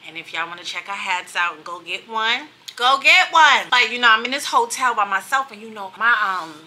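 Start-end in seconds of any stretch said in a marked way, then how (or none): 2.8-3.7 louder, punchy repeat; emphatic push. Real, not acted. Vlogger urging viewers to buy the hats, emphatic and loud. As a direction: drive the point home to the viewers.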